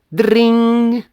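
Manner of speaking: high, loud, sustained ringing noise; imitated doorbell ring. A narrator's reading. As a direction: mimic a shrieking doorbell on one held note. (imitation de sonnette, aiguë et forte)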